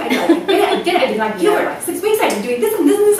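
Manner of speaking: high, excited babble; fan gushing. Retold or imitated. Fan tells doctor she followed his plan, gushing. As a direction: gush it at your idol.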